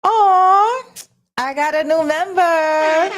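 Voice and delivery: real thrill at a new member; a gushing, high-pitched aww; excited squeal. Real, not acted. New member joins the stream.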